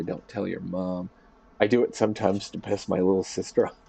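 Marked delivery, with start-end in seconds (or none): 0.0-1.1 soft, slow pretend secret; playfully conspiratorial. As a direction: slip it quietly to the kid. Playing uncle secretly slipping a toy.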